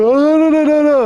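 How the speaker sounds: very high, loud panicked backpedalling; mock panic. Guard imitating the inmate backing away in the cell, mock panic. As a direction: imitate a frightened man backing off in a hurry.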